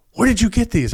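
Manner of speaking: gruff sergeant. Retold or imitated. Sergeant questioning the officer at customs, a hint of gruffness. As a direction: question him like a suspicious sergeant. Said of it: "he's kind of a gruff guy".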